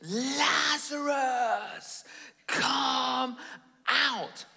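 commanding call into the tomb; loud call. Retold or imitated. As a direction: call him out of the tomb, stretching his name. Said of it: "Jesus called in a loud voice".

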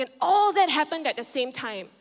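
ordinary talk, nothing marked

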